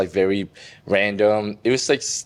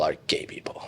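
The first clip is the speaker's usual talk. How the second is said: whispered, softer confession; 7 semitones lower, 9 dB quieter than usual. Retold or imitated. Son whispers his coming out to his mom. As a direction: breathe the words out, barely voiced.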